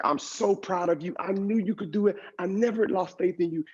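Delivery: high-pitched retold emotional outpouring; tearful proud mother. Mother tearfully praises her son, high emotional imitation. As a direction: imitate mom gushing through tears, lightly acted. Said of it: "She bursts into tears".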